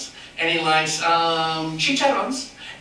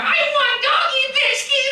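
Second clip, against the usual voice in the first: much higher, slower, insistent plea; begging puppet dog. Acted. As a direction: drag out the begging. Puppet Chico begs louder.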